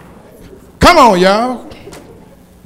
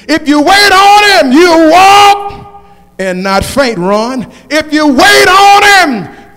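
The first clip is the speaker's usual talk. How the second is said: preaching at full force; 6 dB louder, slower than usual. Acted. Preacher rallying the congregation, full-force whooping sermon.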